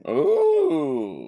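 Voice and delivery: high drawn-out ooh of surprise; a high, impressed, teasing ooh. Real, not acted. Reacting to rating.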